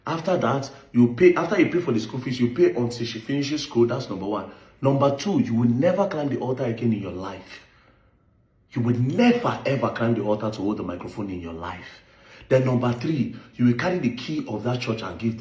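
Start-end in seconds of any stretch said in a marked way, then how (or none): none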